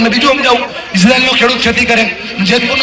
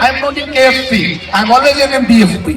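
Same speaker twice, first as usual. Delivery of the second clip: slow spelled-out slogan; 3 semitones higher, slower than usual. Shown as a demonstration. Speaker models a slogan for students.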